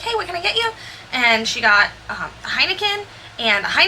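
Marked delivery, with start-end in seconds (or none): 0.0-0.7 customer service voice. Retold or imitated. Greeting a bar customer.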